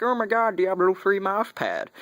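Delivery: high, goofy fanboy outburst; hammed-up excitement. Vlogger imitating his reaction to the mail, hammed-up excitement. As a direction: squeal it in mock amazement, drawing it out.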